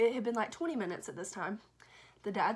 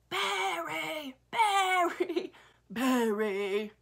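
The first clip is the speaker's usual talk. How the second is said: loud, high, drawn-out yells; screaming for the dog; 6 semitones higher, 7 dB louder, much slower than usual. Retold or imitated. Babysitter on the front porch after the lost dog, screaming. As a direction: yell the name over and over, full out. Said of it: "I start screaming".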